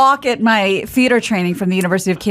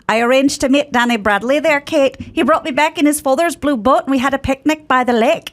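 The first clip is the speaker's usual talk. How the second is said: a gentle Irish accent, pitched higher; 4 semitones higher than usual. Shown as a demonstration. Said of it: "what would that sound like now".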